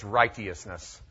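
righteousness as "RIGHT-ee-us-ness"; old English pronunciation. Shown as a demonstration. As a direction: say righteousness with a hard t and "ee-us". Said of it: "driving you nuts with the phrase".

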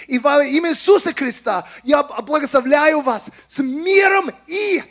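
impassioned high, loud exhortation in Russian; emotional announcement. Real, not acted. Preacher urging Russian-speaking believers to stand up, emotional shout. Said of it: "apologize for these emotional announcements".

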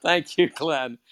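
high, loud, laughing through the words; giddy laughing thanks; laughing cheer. Real, not acted. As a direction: gush thanks while laughing.

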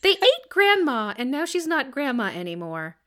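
put-on high child letter-writer voice; high, innocent kid asking Santa; little kid voice. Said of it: "your little kid voice is really creepy".

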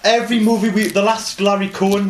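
angry rant. Real, not acted. Ranting about hated director.

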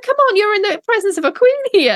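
high playful telling-off; mock scolding. Scolding imagined guest.

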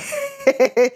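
sudden high-pitched mock laugh; high burst of laughter. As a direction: imitate him bursting out laughing. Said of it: "he busts out".

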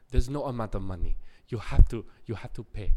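lightly imitated Jamaican accent; a hint of Jamaican accent, firm. Club bouncer demanding payment, in a light Jamaican accent. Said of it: "fucking Jamaican accent in Japan".

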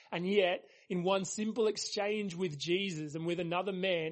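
ordinary delivery

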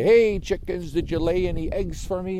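loud, high farmyard call; calling to chickens. Acted. Farmer asks the hens for eggs, calling out. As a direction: call out cheerily across the yard.

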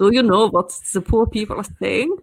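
exaggerated posh German accent; hammed-up posh German lady. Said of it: "with a posh German accent".

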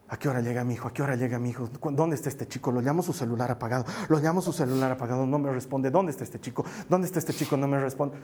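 low, soft, repetitive worry; acted anxious muttering, going round in circles; fretting parent. Pastor as parent waiting for a son at night, low fretful muttering.

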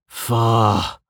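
Anton's waking groan of a curse; groggy Anton. Acted. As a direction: let out a groggy, drawn-out curse.

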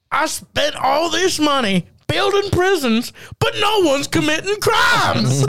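high, slow comic shout; hammed-up wail of a frustrated businessman; mock exasperated outburst. Mock outburst of prison contractor.

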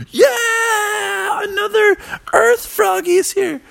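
high, excited crowd cheer; high-pitched jubilant yell; cheering froggies. Acted. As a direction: whoop it like a welcoming party.

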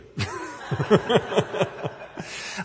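quick unvoiced chuckle; breathy laugh. Real, not acted. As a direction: laugh it off, embarrassed. Laughs at the dare.

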